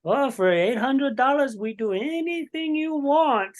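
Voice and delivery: high, drawn-out mock Russian woman's voice; female Russian accent. Host retelling two Russian women propositioning his buddy, female Russian accent. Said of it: "like, female Russian accent".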